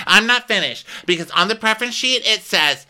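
very high, hammed-up diva insistence; mock demanding rant. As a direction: imitate a guest shrieking out demands. Acted guest's demanding rant.

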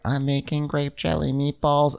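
high, proud hostess voice; a light high proud boast; Catherine impression. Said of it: "she's like".

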